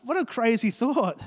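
ordinary talk, nothing marked

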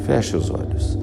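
soft whispered command. Acted. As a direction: breathe the words out gently. Whispered eye-closing cue.

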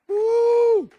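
long, high praise whoop; loud whoop. Real, not acted. Preacher praising Jesus, long high whoop.

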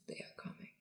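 barely audible eerie whisper; Kingsley. Retold or imitated. Imitating the voice that comes into the party, creepy whisper. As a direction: whisper the warning very softly. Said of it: "Kingsley's voice who's saying this".

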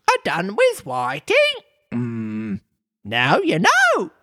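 high squeaky voice; a hint of a deep pronouncement; Griffin. A narrator's reading. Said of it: "in a deep voice".

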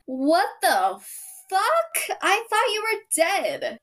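high, stunned outburst; seeing a ghost, pitched high; shocked quote. Retold or imitated. Pocahontas shocked to see him.

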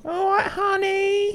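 very high, slow Cockney screech easing down; dramatic Cockney accent. Acted. Imitating a Cockney woman offering food, exaggerated Cockney accent. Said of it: "dramatic Cockney accent on a woman".

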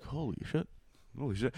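soft, high mock amazement; hushed awe. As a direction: play it stunned, under the breath. Imagined recipient stunned by a picture.

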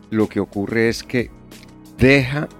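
ordinary talk, nothing marked

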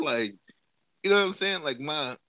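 ordinary talk

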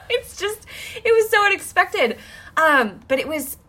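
high-pitched, talking out of a laugh; laughing amazement. Real, not acted. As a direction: marvel while still giggling.

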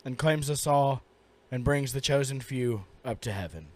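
low, soft, solemn memorial speech; mock eulogy. Co-host eulogizes the lost Choco Taco, mock solemn. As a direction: deliver a grave funeral tribute in jest.

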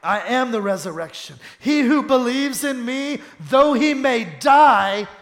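ringing pulpit proclamation; forceful scripture preaching. Real, not acted. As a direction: declaim the verse, rising high and loud.

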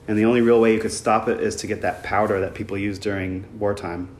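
usual speaking voice

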